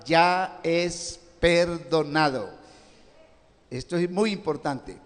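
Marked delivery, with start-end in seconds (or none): 0.0-2.5 "ya es perdonado" very slow and deliberate; emphatic. Real, not acted. Preacher underlining "ya es perdonado" for listeners, slow emphasis. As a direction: draw out "ya es perdonado", weighing each word. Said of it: "Yo me enfatizo mucho esa palabra".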